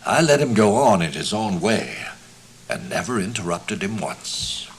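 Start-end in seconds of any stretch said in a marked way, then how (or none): none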